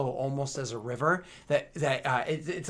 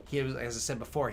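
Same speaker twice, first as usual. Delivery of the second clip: ordinary delivery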